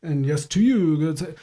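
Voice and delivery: lightly mocking gentlemanly politeness. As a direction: put on a touch of mock courtesy. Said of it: "mockingly way".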